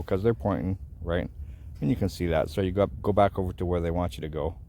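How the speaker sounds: normal talking voice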